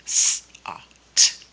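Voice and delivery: s-, ah, t as separate sounds, hissed s first; sounded out. Shown as a demonstration. Teacher showing the made-up word sought, sounded out. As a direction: say s-, ah, t sound by sound with gaps. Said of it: "just do it from sounds".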